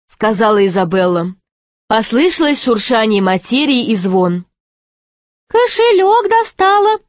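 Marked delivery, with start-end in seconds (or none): 5.5-7.0 very high jester voice, fully voiced; jester's confidential aside. A narrator's reading. Jester reports to companions on queen's purse, high aside. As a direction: pass it on in a high, thin aside. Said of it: "шепнул Шут".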